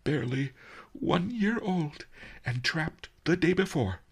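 put-on high, tearful old master; weepy, high-pitched lament; sniffling. A narrator's reading. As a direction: answer through mock-mournful sniffles. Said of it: "Master Li sniffled".

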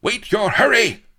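loud, high-pitched villain bellow; thundering. A narrator's reading. Don Lardo silences his captive, thundering. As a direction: thunder it at full force. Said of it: "thundered Don Lardo".

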